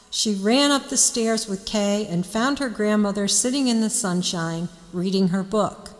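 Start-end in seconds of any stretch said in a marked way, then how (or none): none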